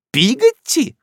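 high incredulous outrage; indignant. A narrator's reading. Miss Betsey outraged at the maid's name, indignant. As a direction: repeat the name in disgust. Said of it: "повторила с каким-то негодованием".

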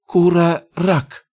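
high, slow trembling goblin cry; trembling whisper. Acted. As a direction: goblin voice shaking with emotion. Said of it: "прошептал он дрожащим голосом".

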